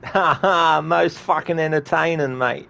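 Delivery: high, loud Australian laughing bloke; a broad Aussie bystander amused; mock Aussie NPC. Continues Aussie NPC bit.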